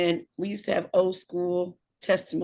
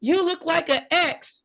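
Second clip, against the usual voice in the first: high-pitched teasing blurt; mimicked godchild; 8 semitones higher, 3 dB louder than usual. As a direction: blurt it out like a tickled kid. Imitates godchild's gleeful remark.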